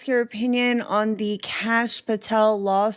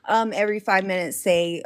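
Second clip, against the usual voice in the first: usual speaking voice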